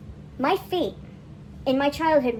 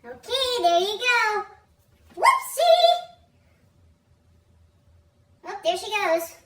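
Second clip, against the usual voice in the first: higher, lilting mock-cheerful mother; playful mom voice; 8 semitones higher, 5 dB louder, much slower than usual. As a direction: tease the kid as you dunk her. Mom dipping child, playful voice.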